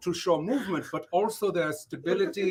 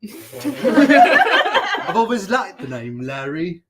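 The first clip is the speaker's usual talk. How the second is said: laughing; 6 dB louder, much slower than usual. Real, not acted. Host joking on stream about the name Larry, laughing. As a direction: giggle the words out.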